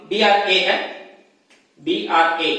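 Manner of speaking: usual speaking voice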